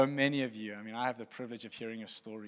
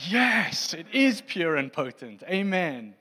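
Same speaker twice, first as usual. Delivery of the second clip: high, loud, eager "Yes"; mock excited congregation; 6 semitones higher, 10 dB louder, slower than usual. Pastor imagining congregation's reply, excited shout. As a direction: voice the crowd's enthusiastic yes. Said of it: "you'll be like".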